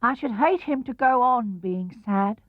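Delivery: small, unsure voice; a hint of worry; lightly voiced doubtful Piglet. A narrator's reading. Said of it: "said Piglet doubtfully".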